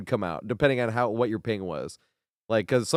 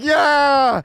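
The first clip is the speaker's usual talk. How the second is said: high loud triumphant shout; excited yell; 14 semitones higher, 9 dB louder than usual. Retold or imitated. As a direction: yell in victory. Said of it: "you're like".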